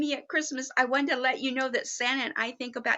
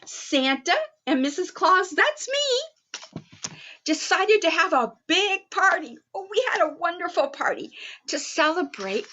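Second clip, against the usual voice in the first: plain reading voice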